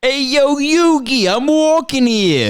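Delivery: put-on high, slow Brooklyn accent; Joey's New York twang, pitched high. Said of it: "He has a Brooklyn accent".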